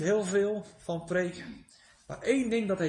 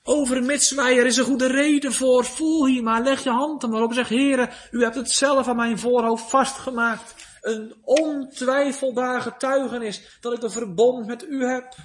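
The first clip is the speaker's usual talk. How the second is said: loud, higher, insistent plea; fervent plea; 5 semitones higher, 5 dB louder than usual. Acted. Preacher voices the believer pleading God's covenant, loud and fervent. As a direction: press the promise home with full force.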